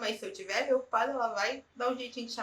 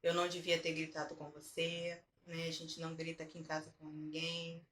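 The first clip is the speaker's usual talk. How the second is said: softer, lower voice, sincerely owning up; gentle apology; 4 semitones lower, 6 dB quieter than usual. Retold or imitated. Mother apologising to daughter after shouting, soft and low. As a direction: apologise quietly as to a small child. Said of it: "E aí eu explico".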